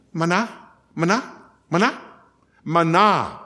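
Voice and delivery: 玛拿 said slowly, each time rising, stress on the last syllable 拿; Hebrew word manna. Shown as a demonstration. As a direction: teach 玛拿 with the stress on 拿. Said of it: "今天你们要学会一，一个希伯来字".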